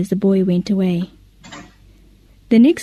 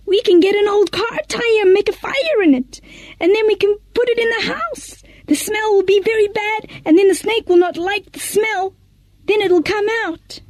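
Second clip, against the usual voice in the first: much higher villager voice with a plan; eager villager; 10 semitones higher than usual. Acted. One villager telling the crowd how to smoke the snake out, eager. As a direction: pitch the idea eagerly.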